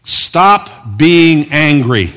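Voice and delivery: slow, stretched, with a pretend angry edge; mock angry command. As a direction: play at scolding them slowly. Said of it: "I was being angry there".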